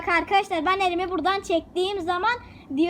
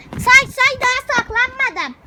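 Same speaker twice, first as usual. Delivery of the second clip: calling out; 6 semitones higher, 7 dB louder, slower than usual. Real, not acted. Hide and seek, calling out.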